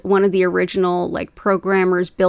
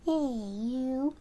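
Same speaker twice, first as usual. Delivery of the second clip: pet talk; 5 semitones higher, 12 dB quieter than usual. Real, not acted. Coaxing her cats Hattie and Bubba over, very high soft baby talk. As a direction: coax the kitty over in a high sing-song. Greeting the cat.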